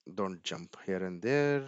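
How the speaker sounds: ordinary delivery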